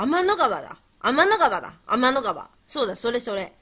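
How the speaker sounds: sudden loud burst of discovery; excited realisation. Real, not acted. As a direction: call out the answer you just remembered. Quiz answer suddenly comes to her.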